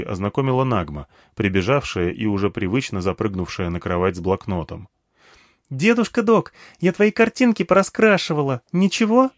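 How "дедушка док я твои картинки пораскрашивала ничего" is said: put-on high-pitched eager child voice; chirpy little girl, much higher. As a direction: pipe up like a small girl asking grandpa. Narrator voices little girl Nagma.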